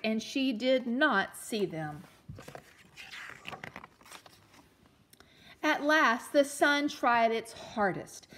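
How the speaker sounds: put-on storytelling read-aloud for children; gentle read-aloud storyteller; story narration. A narrator's reading. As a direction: read it like a picture book to a class.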